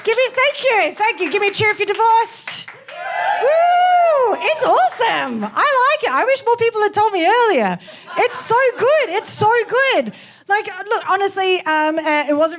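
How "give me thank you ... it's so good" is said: high, whooping celebration; gleeful cheering. Acted. Comic rallying the audience to cheer divorce, gleeful. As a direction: whoop it up with drawn-out, high delight.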